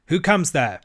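ferret snaps a loud challenge; sharp challenge. A narrator's reading. Ferret guard challenging Toad at the gate, loud and sharp. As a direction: bark out the sentry's question. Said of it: "said the ferret sharply".

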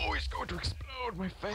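high-pitched alarm; panicked yelp. Real, not acted. Gamer sees an explosion coming at him, high panicked cry. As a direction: yelp as danger closes in. Creeper about to explode nearby.

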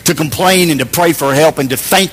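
closing prayer. Acted. Pastor praying to God for the church, reverent and calm. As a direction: pray it over the congregation gently.

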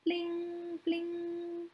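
soft high "bling, bling" like plucked strings; a gentle sung-out plucking sound; lute twang imitation. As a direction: imitate a lute note, softly.

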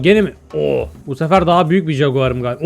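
normal talking voice

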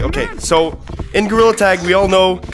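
ordinary delivery

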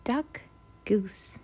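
ordinary delivery, nothing marked

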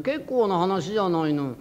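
normal talking voice

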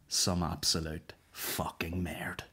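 slow, breathy whisper of disgust; slow hushed whisper, leaning in; whispered aside. Real, not acted. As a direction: drop to a hushed whisper to swear. Confiding aside.

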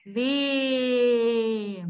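"Lê" sustained on a long E; teacher sounding out "Lê", very long vowel; syllable demo. Shown as a demonstration. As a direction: draw out "Lê" to show the E sound. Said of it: "olha lá o E".

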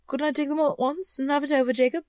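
quick, high, hopeful question; tentative hinting. A narrator's reading. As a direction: float the suggestion hurriedly. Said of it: "hinted Scrooge".

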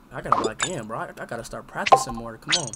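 ordinary talk

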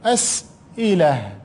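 أسئلة drawn out slowly, syllable by syllable; slow dictation. Shown as a demonstration. Teacher to pupils, drawing out أسئلة slowly. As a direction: stretch out أسئلة for the class. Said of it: "teacher drawing out word".

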